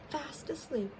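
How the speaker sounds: usual speaking voice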